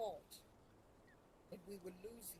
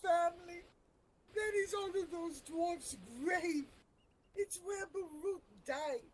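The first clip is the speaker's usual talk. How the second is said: emotional outburst, pitched up and slowed; overcome with emotion; 7 semitones higher, 4 dB louder than usual. Real, not acted. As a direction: push through real tears over a lost friend. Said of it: "I'm getting so emotional".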